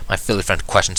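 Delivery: usual speaking voice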